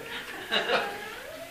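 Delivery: a bright rising laugh, real amusement; high laugh. Real, not acted. Preacher laughing at his own joke to the congregation, real laugh rising high.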